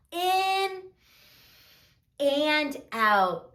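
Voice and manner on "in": ordinary delivery, nothing marked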